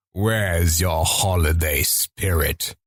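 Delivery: very low, slow villain voice; Reaper. Acted. Quoting game voice line, low slow villain. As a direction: intone it like a menacing game villain. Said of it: "These are two voice lines for Reaper".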